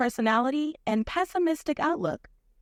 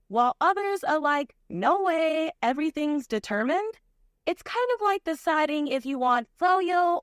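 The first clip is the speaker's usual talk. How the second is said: put-on Valley Girl accent. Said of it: "the perfect voice and, um, personality".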